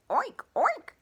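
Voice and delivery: high, loud oinks; playful pig oinks; oinking pig. Acted. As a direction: oink like a pig. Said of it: "all the librarian heard was".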